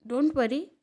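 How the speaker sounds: ordinary talk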